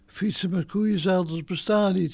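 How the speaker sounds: usual speaking voice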